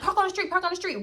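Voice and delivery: high, fussy imitation of a friend; irritated. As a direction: repeat the order with mock irritation. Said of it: "she was kinda irritated".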